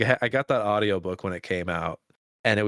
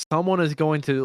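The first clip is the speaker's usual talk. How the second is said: normal talking voice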